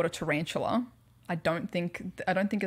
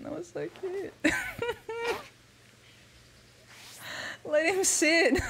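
cutesy coo; 11 semitones higher than usual. Real, not acted. Gushing over the pet.

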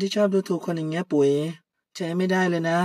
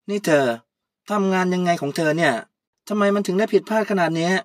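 louder, sharp boss scolding her employee; bossy scolding; 3 semitones higher, 6 dB louder than usual. Acted. Puy scolding Kaew over her mistakes, louder. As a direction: tell her off like an angry boss.

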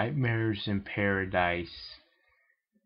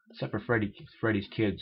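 ordinary delivery

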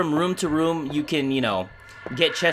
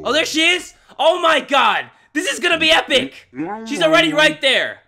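excited shouting; 9 semitones higher, 4 dB louder than usual. Real, not acted. Excited at monster.

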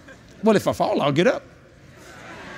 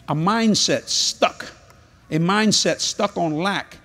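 slow and deliberate; preacher driving the point home slowly; slow emphasis; much slower than usual. Acted. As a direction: drag out "mindset stuck on lack" word by word. Preacher hammers key phrase.

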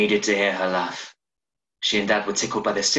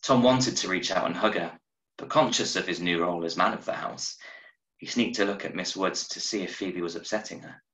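plain reading voice

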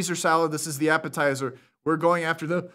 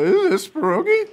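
high squeaky mock voice; 14 semitones higher, 7 dB louder than usual. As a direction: squeak it out like a cracking voice. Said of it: "your voice just squeaked".

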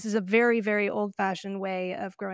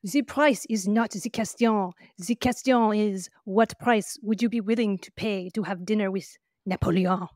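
hammed-up French accent; terrible French accent; 4 dB louder than usual. Host quoting the winemaker from the film, terrible French accent. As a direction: quote it in a deliberately bad French accent, measured pace. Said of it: "forgive my terrible French accent".

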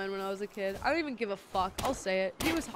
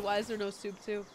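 ordinary talk, nothing marked

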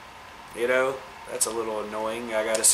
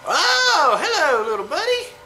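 excited greeting; 6 dB louder than usual. Real, not acted. Greeting cute alien creature.

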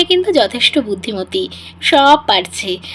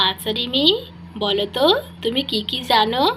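ordinary talk, nothing marked

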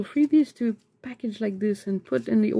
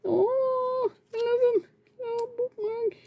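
small squeaky voice, melting over something cute; high, adoring squeal; high-pitched gushing; 13 semitones higher, 3 dB quieter, slower than usual. Real, not acted. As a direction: coo it very high, almost whimpering with love.

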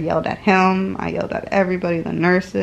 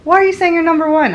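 higher, loud mock outrage; indignant; 10 semitones higher, 7 dB louder, faster than usual. Real, not acted. Older sister protesting the number one claim, indignant. Sibling squabble, laughing outrage.

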